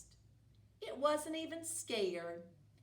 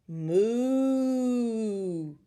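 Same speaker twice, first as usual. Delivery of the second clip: long lowing moo; cow moo; 11 dB louder than usual. Acted. Cow mooing back at the witch.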